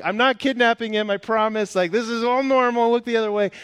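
high, loud mock-panicked pleading; acted frantic reassurance to strangers; flustered public protest. Flustered dad appeals to staring parents.

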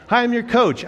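put-on high, loud, bright hello to the kids; cheery greeting. New coach greets scared six-year-olds, high and loud.

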